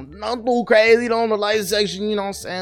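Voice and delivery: high, hyped-up host voice, playing it up; mock hype voice. Host hyping the episode sections to listeners, mock high hype. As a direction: pitch it way up like a cheesy radio host.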